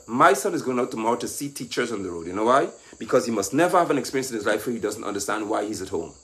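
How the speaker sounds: usual speaking voice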